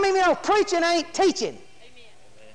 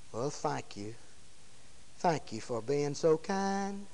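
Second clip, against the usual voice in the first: very low, hushed politeness; near-whispered thanks; 12 semitones lower, 14 dB quieter than usual. Acted. Mock healed man thanking Jesus, dropping nearly to a whisper. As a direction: murmur a meek, tiny thank-you. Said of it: "to whispering".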